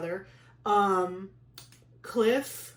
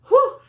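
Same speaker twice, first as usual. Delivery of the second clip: high, loud exhaled whew; emotional whew; 16 dB louder than usual. Real, not acted. Pregnant woman nearly crying at the memory, emotional whew. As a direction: blow out a sharp whew to hold back tears. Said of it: "I can almost cry".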